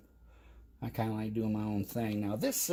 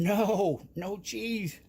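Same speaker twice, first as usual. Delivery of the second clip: mocking objectors; 9 semitones higher, 3 dB louder, much slower than usual. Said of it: "people out there going".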